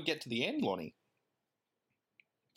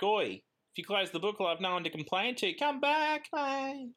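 high grouchy puppet whine, rising at the end; Oscar voice; 8 semitones higher than usual. Retold or imitated. Host voicing grouchy Oscar to his co-host, high whiny plea. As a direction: whine it like a grumpy puppet begging them back. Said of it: "he's like".

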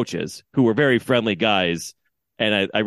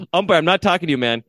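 higher, pointed brush-off; mock dismissive aside; passive aggressive; 6 semitones higher, faster than usual. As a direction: wave the umpire off mid-rant. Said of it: "kind of passive aggressive".

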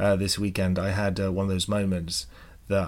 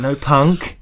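imitated uptalk, "punk" louder and rising; higher, louder "No, punk" with a questioning lift on "punk"; upward inflection; 4 semitones higher, 7 dB louder than usual. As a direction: mimic her with "punk" flung up like a question. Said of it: "so she spoke like that".

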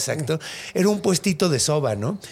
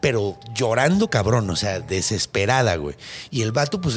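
normal talking voice

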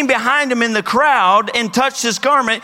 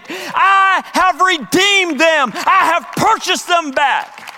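crying out; 7 semitones higher, slower than usual. Acted. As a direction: cry it out slowly like a triumphant verdict. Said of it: "the blood of Jesus Christ is crying out".

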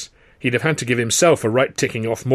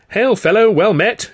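loud, much higher hearty hail; cried-out greeting; 11 semitones higher, 7 dB louder, slower than usual. A narrator's reading. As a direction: call it across the pub, big and booming. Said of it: "he cried out".

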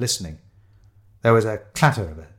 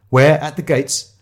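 ordinary delivery

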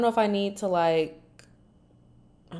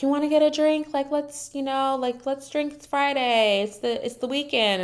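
higher-pitched imitation of a friend coaxing; retold upbeat invitation, pitched up; 7 semitones higher than usual. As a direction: lightly act out a friend urging drinks. Friend coaxing Sai into a drink.

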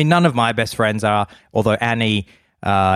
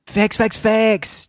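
hyped host; 10 semitones higher, much slower than usual. Retold or imitated. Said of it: "Zayn goes".

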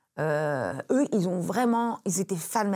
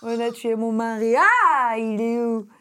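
tragic actress; 3 semitones higher, 6 dB louder, slower than usual. Pretend. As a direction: play the grieving widow over the top. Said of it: "je rêvais de faire des tragédies".

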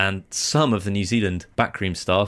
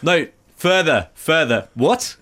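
mock fielder's call, higher and drawn out; 8 semitones higher, 5 dB louder, slower than usual. Fielder to teammates while setting the field, mock calling out. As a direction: shout orders across a pitch, playfully.

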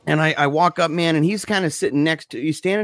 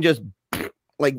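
usual speaking voice

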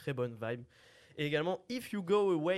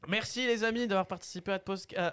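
remerciements enjoués; 8 semitones higher, 6 dB louder than usual. Acted. Remercie les invités, fin d'émission.

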